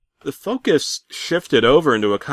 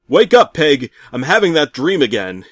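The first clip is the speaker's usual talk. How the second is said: urgent call; 5 semitones higher, 7 dB louder than usual. Acted. Calling his wife awake.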